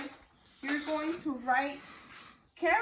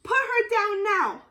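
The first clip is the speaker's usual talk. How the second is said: loud, higher bellow; bellowing woodcutter; 6 semitones higher, 7 dB louder than usual. Acted. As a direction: roar the order at the wolf. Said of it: "below the woodcutter".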